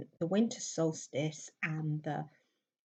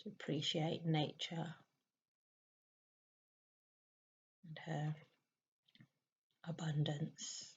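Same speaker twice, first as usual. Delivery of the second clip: soft meditation voice with long pauses; hushed, spacious meditation guidance; guided meditation; 5 dB quieter than usual. Real, not acted. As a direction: guide a listener gently into stillness, leaving long silences.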